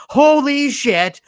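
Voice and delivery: loud, high, drawn-out exclamation; imitated movie exclamation at full volume; quoted outburst. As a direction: blurt it slowly, stretching each word. Quotes favorite outburst from film.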